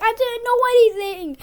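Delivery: high, loud, whining denial; mock whiny protest. Boy mimics Jack denying it to the teacher, high whine. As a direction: play the kid pleading his innocence, whiny.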